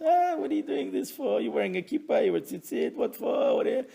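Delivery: lightly mock the doubters; mocking scoffer. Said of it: "those who make fun of us".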